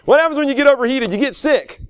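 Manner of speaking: high, fast punchy question and answer; emphatic preaching. Real, not acted. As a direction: drive the point home to the congregation. Repeats the lesson with preaching force.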